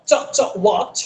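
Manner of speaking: ওয়াচ ending on a crisp ch; ch sound. Shown as a demonstration. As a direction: snap the ch at the end of ওয়াচ. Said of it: "ওয়াচ এর শেষে যে একটা চ আছে".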